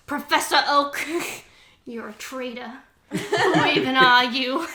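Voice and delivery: giggling mock outrage at a betrayer; laughing accusation. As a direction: accuse him while cracking up. Meowth confronts traitor Oak.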